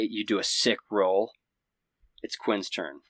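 normal talking voice

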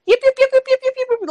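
high, sharp little dog yips; yappy chihuahua voice. Retold or imitated. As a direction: yap like a tiny chihuahua. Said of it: "Chanel be chipping like a ch-, a little chihuahua".

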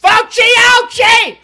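high, slow, goofy yell; silly shout. Acted. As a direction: belt out the joke loudly.

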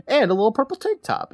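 ordinary talk

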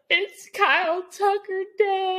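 high, drawn-out fan squeal; imitated cute freak-out. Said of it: "she gets really cute about it".

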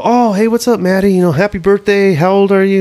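high, eager greeting voice; imitated friendly brother. Brother greeting Matty on his birthday, high friendly imitation. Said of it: "D J's like".

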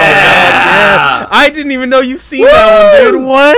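loud, high burst of real excitement; excited shout. Real, not acted. Friend hears the anime pick Detroit Metal City, excited shout. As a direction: shout it in thrilled disbelief.

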